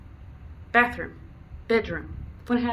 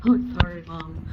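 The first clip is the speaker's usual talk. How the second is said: dog's voice; 5 dB louder than usual. Retold or imitated.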